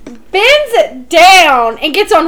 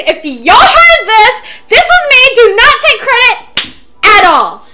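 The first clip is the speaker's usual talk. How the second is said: raised, louder mock yell; retold scream; 6 semitones higher, 4 dB louder than usual. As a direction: shout it out to the crowd. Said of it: "I literally screamed".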